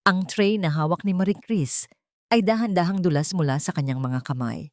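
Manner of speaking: usual speaking voice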